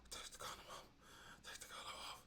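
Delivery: breathy whisper in his ear. Retold or imitated. Retelling a girlfriend whispering in his ear. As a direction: whisper it close and low.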